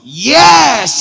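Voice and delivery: loud, high, drawn-out YES; eager mock shout. Preacher re-enacts his answer to the man, eager mock shout. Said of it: "Akhirnya saya jawab".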